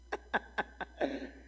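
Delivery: unvoiced staccato chuckle; amused laugh. Real, not acted. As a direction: chuckle breathlessly at your own story. Laughs at his own story.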